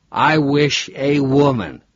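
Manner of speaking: put-on slow, drunken echo of "I wish a woman.", leaning on "I"; a drunk parroting the cabbie's odd phrasing, slowly; "I wish a woman." dragged out, a falling mock-imitation. Robert mockingly echoes the driver.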